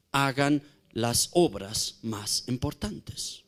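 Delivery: low, soft and slow; hushed command. Acted. Preacher repeating Jesus' command to the church, hushed. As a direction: press it in quietly.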